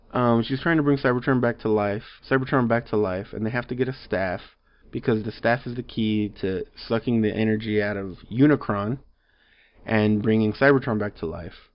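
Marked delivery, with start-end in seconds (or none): none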